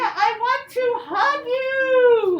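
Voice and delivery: high, loud, stretched-out cry of joy; boy bursting with happiness, high and drawn out; overjoyed Henry. Acted. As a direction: squeal it like a thrilled boy. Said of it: "Henry said".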